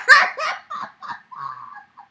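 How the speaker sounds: high delighted cackle; shrieking laugh. Real, not acted. Laughter after shout.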